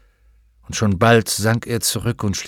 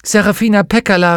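high, loud cry of recognition; calling out; 10 semitones higher, 7 dB louder than usual. Acted. Lyra greets the witch she knows, calling out. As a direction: call the name out loudly. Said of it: "rief sie".

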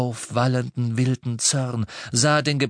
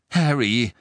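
urgent call; 6 dB louder than usual. A narrator's reading. Hermine grabs his arm.

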